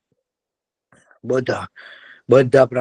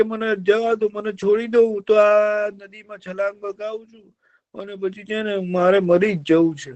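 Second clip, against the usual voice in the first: whining fox; 9 semitones higher than usual. Acted. Fox tells the deer he wants to die, whining. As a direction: moan like a fox faking misery.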